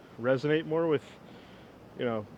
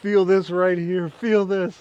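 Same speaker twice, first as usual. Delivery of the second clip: higher, louder mock panic, drawn out; retold freak-out; 6 semitones higher, 6 dB louder, slower than usual. Patient freaking out to the doctor, high, loud mock panic. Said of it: "I was freaking out".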